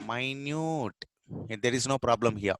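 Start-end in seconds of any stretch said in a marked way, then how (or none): 0.1-0.9 "minute" as my-NYOOT, drawn out; adjective pronunciation. Shown as a demonstration. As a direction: stretch "minute" into "mine-yoot". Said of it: "the pronunciation is, uh, uh, totally different".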